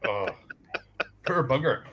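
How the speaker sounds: normal talking voice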